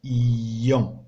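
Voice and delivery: إيون said slowly and clearly for the class; slow model word. Shown as a demonstration. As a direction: drag out إيون syllable by syllable. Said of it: "والصوت ديال إيون".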